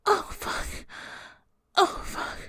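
loud, high ecstatic cries; climax cries. Acted. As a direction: let it break out at the peak. Climax in erotic roleplay.

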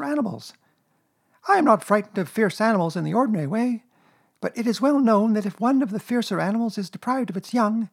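high-pitched little worrier; Piglet, nervous. Acted. Piglet fretting to Rabbit about fierce Kanga, nervous. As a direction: voice a small anxious animal, very high.

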